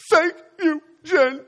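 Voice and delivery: very high, loud crying toddler impression; mock sobbing. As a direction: blurt it out like a bawling little child. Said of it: "Just like that".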